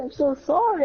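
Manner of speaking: pleading apology. Retold or imitated. Boy apologises to friend after peeing in his eye, high anxious plea. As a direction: apologise in a panic. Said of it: "I said".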